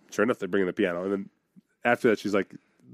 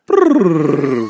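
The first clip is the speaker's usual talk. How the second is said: a buzzing lip trill, louder; slide noise; 4 semitones higher, 7 dB louder than usual. Retold or imitated. Kid going down the slide, buzzing lip trill. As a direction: imitate the splash slide rumbling. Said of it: "it goes".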